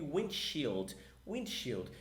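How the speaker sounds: windshield said as win-shild, slowly; English pronunciation. Shown as a demonstration. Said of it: "Como é que é para-brisas em inglês?".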